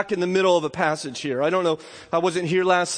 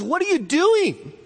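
much higher, slow incredulous question; lightly voiced Jesus, amazed then welcoming; Jesus rebuking; 9 semitones higher, slower than usual. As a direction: gently scold the disciples, astonished. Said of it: "And Jesus looks at them and says".

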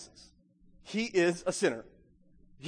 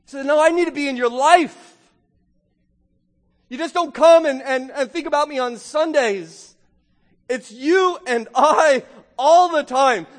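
emphatic preaching; 8 semitones higher, 7 dB louder than usual. Acted. Preacher voices Jesus telling Zacchaeus he's moving in, emphatic. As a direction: preach it insistently, voice raised.

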